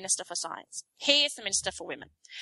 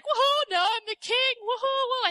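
much higher whooping cheer; gloating cheer; 13 semitones higher than usual. Retold or imitated. Rudd back in power, cheering high and gloating. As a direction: whoop like you just won the crown. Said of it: "he's, like, cheering".

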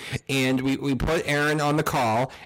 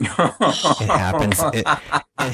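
slow words broken by laughter; laughing; 3 semitones higher, 5 dB louder, much slower than usual. Real, not acted. Laughing at own mistake.

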